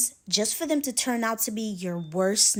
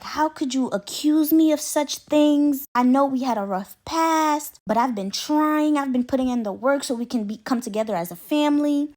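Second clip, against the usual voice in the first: mock sobbing; 6 semitones higher, 4 dB louder than usual. Mother-in-law sobbing on the phone to her son, mock wail. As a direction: imitate a wailing mom playing the victim. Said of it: "she's boohoo sobbing on the phone".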